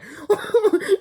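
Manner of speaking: loud high laugh. Real, not acted. Laughing at the cartoon, high laugh. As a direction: burst out laughing.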